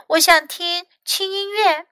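high little-one voice; small child's voice. A narrator's reading. Hurt Louis asks Fox to help, high small voice.

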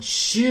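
秀 with a drawn-out vowel; long vowel. Shown as a demonstration. Teacher reading the surname 周 in Japanese, 秀 held long. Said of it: "就是长音".

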